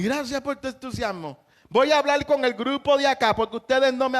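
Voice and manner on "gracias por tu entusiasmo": softer, lower, deadpan thanks; soft mock thanks. Preacher mocking pastors who thank the crowd, softly deadpan. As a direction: murmur the pastor's hollow gratitude.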